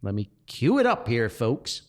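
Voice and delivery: usual speaking voice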